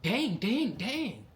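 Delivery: high-pitched repeated dismay; dismayed exclamation. Retold or imitated. Recruit seeing the shaved head, dismayed high cry. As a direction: squeal it in mock dismay. Acted reaction to the haircut.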